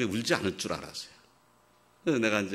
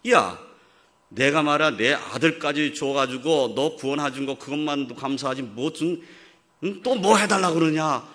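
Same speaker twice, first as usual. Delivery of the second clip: loud, higher hammed-up rebuke; a hammed-up stern heavenly father; mock scolding God; 6 semitones higher, 9 dB louder than usual. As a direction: bark it like a put-upon father. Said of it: "이러실 분이냐".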